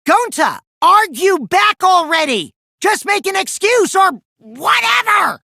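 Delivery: high, loud, impatient yell; angry yelling. Acted. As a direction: snap at him to fight back. Angry outburst in class trial.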